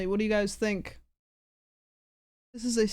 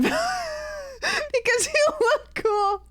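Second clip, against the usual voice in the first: laughing; much slower than usual. Real, not acted. Laughing while complimenting friend's look.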